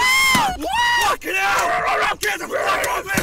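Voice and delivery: yelling in a panic, very high and drawn out; a dad's panicked yell; panicked shout. Acted. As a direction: scream it like something just grabbed you. Dad attacked at home.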